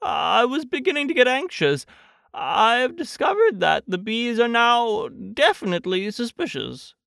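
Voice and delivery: high-pitched, earnest Pooh; fretful storybook bear. Acted. Pooh tells Christopher Robin the bees suspect, high and fretful. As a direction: report the worry in a high innocent voice. Voices Pooh reporting from balloon.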